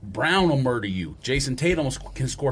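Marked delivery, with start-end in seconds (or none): none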